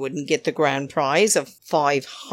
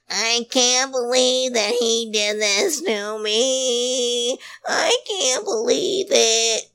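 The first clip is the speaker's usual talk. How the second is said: higher, slow whiny mock of a houseguest; slow, higher mocking impression of a whiny player; Nicole's voice; 7 semitones higher, slower than usual. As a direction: imitate her drawn-out, higher whimper. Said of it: "But she says it in her voice".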